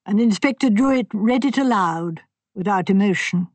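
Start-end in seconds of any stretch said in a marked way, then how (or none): none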